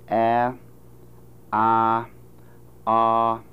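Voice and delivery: "A, ah, ah" as three held vowels, front to back; vowels five, six, seven. Shown as a demonstration. Phonetician demonstrating vowel series, "A, ah, ah" moving backward. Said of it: "Let me do the five, six, and seven".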